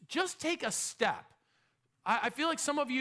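ordinary talk

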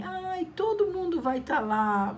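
mock child pleading with mother; whiny kid. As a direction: beg like a kid asking mom for a party. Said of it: "a gente falava pra nossa mãe".

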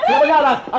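loud shout breaking into a laugh. Acted. Wife chasing Konstantinos out, shouting and laughing. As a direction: yell it again through laughter.

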